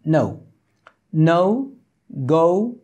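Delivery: "no" and "go" with oh-oo glide, spaced; English vowel demo. Shown as a demonstration. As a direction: say "no", "go" singly, gliding to oo. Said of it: "এরকম". ("no", "go" নৌ-এর মতো, আলাদা করে)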